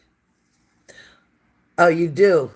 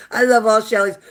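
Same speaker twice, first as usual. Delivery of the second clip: laughing; 5 semitones higher, 3 dB louder than usual. Real, not acted. Delighted at viewer's five dogs.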